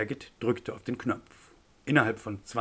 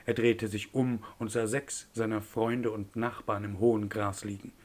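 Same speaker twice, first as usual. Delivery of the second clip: usual speaking voice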